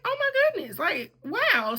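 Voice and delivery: very high awed exclamation, easing down; retold amazement. Telling viewers of seeing the sorority stuff, amazed. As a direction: gush as if just shown treasures.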